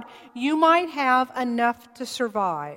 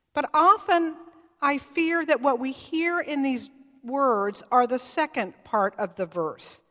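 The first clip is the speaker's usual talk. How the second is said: ordinary delivery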